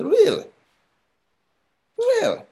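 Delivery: "Really?" jumping way up high in mock disbelief; a sarcastic, sky-high "Really?" twice over. Mocking believers' fearful attitude.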